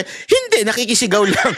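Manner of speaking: high, innocent mock answer; high silly reply. Busybody admitting he only joins the shouting, high and silly. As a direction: squeak out the excuse like a busybody.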